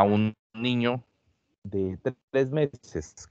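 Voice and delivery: "un niño de tres meses" estirado muy despacio, palabra por palabra; énfasis lento. Shown as a demonstration. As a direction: recalca "tres meses" alargando cada sílaba. Teacher stresses infant's age. (profesor recalca a los alumnos la edad del bebé, muy despacio)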